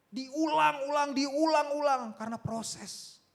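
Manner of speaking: loud, high, slow repeated exhortation; full-force preaching. Real, not acted. Preacher telling congregation healing is a process, forceful preaching. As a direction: drive the point home at full volume.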